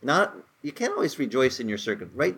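ordinary delivery

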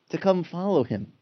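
usual speaking voice

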